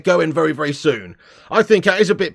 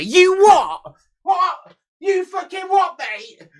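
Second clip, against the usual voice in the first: much higher shouted you what; mock outraged disbelief; 13 semitones higher, 4 dB louder, much slower than usual. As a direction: play-act stunned fury at the numbers. Mock outrage at view count.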